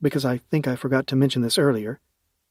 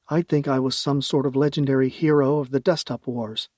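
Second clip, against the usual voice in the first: usual speaking voice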